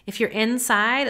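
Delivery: ordinary talk, nothing marked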